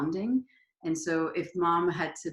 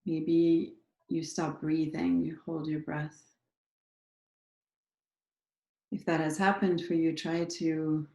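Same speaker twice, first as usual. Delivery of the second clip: unhurried meditation leader, pausing after each prompt; guided meditation. Acted. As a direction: guide listeners gently into their bodies, leaving space between phrases.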